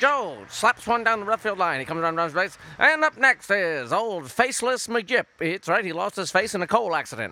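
brisk, brassy ballpark call; lightly hammed baseball announcer; old-time radio announcer. Fake baseball play-by-play.

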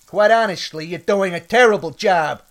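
loud, blunt rebuke; angry criticism. Acted. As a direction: lay into the boss, raising the voice. Cook attacks the boss's work.

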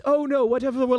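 high start, dropping into confusion; dismayed villain. Acted. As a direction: start high in mock dismay, then falter. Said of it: "Yeah, he says".

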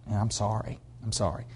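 put-on girl quietly apologising as she is led out; whispered apology. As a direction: barely voice it, ashamed. Departing girl apologises under breath.

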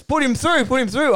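high, loud and keen; mock eagerness. [name] wanting the caller put through, acted excitement. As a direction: urge it eagerly, pitched right up.

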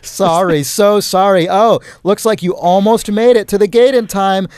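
hammed-up Canadian accent; Canadian accent impression. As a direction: fake a Canadian saying sorry, stretching the last one. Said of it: "is that your Canadian accent impression".

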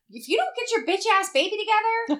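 high, loud scold breaking into a laugh; mock exasperated scolding. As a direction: tell off the screaming baby, half laughing. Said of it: "I'm like".

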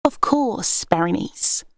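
sweetly. A narrator's reading. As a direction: flatter the big sister sweetly. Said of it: "said sweetly".

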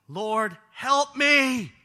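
loud, high desperate cry for help; pleading yell. Acted. Kneeling woman pleading with Jesus, loud and desperate. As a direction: beg him at full voice. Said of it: "That's her yelling. That's her pleading".

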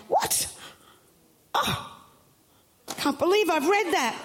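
high yelp of surprise, then a stunned trail-off; as if the page just floored you; shocked disbelief. Retold or imitated. Preacher reliving reading Judges, shocked disbelief. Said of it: "I'm just like".

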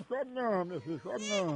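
low, soft mock hushing; soothing a baby. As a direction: sink into a pretend lullaby-like aside. Feigns hushing a crying baby.

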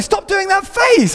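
high-pitched, drawn-out mock scolding; imitated scolding wife. Wife scolds him, retold.

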